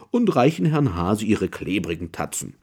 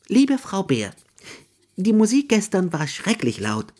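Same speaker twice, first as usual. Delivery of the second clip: high, quick hare voice, grudging then fond; a fussy hare writing to his neighbour, partly fast; Mr Hare reading his letter; 8 semitones higher, 3 dB louder than usual. Acted.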